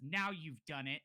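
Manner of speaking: put-on loud, accusing kid; scolding. Kid saunters out to blame the stranger, scolding.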